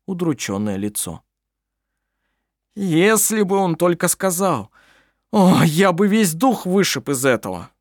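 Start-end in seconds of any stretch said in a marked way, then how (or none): 2.8-7.7 high, strained helpless fury; a weepy man swearing revenge; tearful angry man. A narrator's reading. Klampin's helpless threat.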